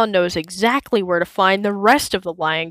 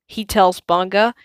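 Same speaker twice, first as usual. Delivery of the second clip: usual speaking voice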